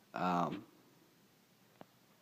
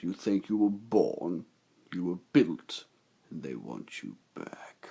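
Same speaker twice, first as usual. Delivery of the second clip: lowered, slow menacing machine voice; robot villain voice; 3 semitones lower, slower than usual. Acted. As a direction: threaten like a bounty hunter robot. Voicing Lockdown's line.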